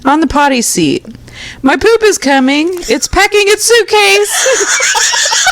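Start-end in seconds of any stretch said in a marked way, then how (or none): 1.6-5.4 put-on high, slow, giggling kid line; laughing kid quote. Toddler on the potty, quoted through laughter. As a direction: squeak it out laughing.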